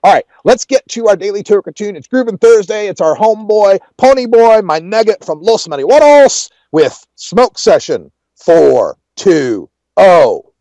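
big radio host build-up; DJ song intro. Real, not acted.

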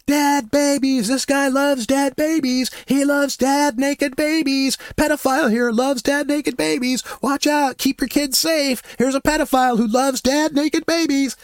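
broadcast it to the whole crowd; yelling through a handheld megaphone. Retold or imitated. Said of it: "I just yelled at him through the megaphone".

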